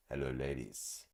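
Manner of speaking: low, soft come-on; mock seductive greeting. Greeting women in a photo.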